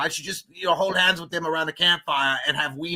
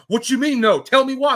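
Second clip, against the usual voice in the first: mock indignant commenter; 8 semitones higher, 6 dB louder than usual. Imagined commenter demanding an answer.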